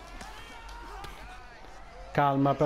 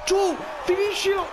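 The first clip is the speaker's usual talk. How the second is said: excited shout; 11 semitones higher than usual. Real, not acted. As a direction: urge the fighter on, yelling high. Cheering a knockdown in the match. (urlo esaltato e acuto)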